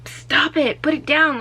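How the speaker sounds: urgent scolding. Retold or imitated. Tani swats his hand.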